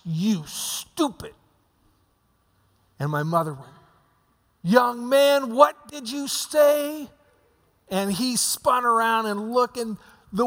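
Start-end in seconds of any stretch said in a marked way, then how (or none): 0.0-1.3 put-on slow muttered child voice, lightly done; four-year-old mutter. Brother turning away from mom, muttering like a four-year-old. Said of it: "in that four-year-old voice muttered under his breath". 4.6-7.1 high, slow scolding mom voice; stern mother. Retold or imitated. Mom confronting her four-year-old son, high and stern.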